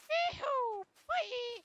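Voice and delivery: joyful high squeal; gleeful whoop. Real, not acted. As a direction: whoop like you're riding a rollercoaster. Gleeful cheer during play.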